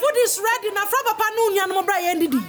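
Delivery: high call to come eat; yelling across the room. Acted. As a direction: shout the meal is ready. Mother calling child to eat.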